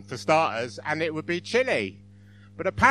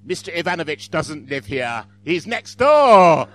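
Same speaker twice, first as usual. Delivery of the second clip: shouted punchline; 12 dB louder than usual. Acted. Frightened resident answering the KGB at the door, comic shout. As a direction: build to a slow, drawn-out shout. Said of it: "And you reply".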